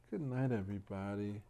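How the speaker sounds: ordinary delivery, nothing marked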